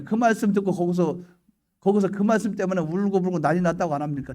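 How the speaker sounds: ordinary talk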